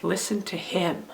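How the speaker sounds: soft, weighty "him" at the end; stress on HIM. Shown as a demonstration. Teacher showing children the third-word reading, stress on "him". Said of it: "if we stress the last word".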